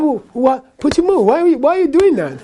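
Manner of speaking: high-pitched, baffled demand; exasperated Russian listener. Retold or imitated. Russian man questioning the speaker about the banging, exasperated. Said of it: "this Russian guy goes".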